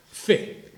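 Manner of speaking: "Fe" with a heavy, airy F burst; exaggerated F. Shown as a demonstration. Speaker shows the audience how to pronounce it, exaggerated F on "Fe". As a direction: blow out the F of "Fe" as a theatrical demonstration. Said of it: "porque si no, no la pronuncias bien".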